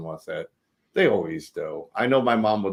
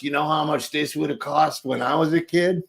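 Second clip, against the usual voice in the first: lightly voiced old man grumbling; a hint of a grumpy grandpa; 7 semitones higher than usual. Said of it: "grandpa voice".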